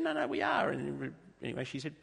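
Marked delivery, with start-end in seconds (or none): 0.0-0.7 high, loud mock protest; hammed-up insistence; insistent friend. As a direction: insist it's true, pitched way up. Friend insisting in retold story.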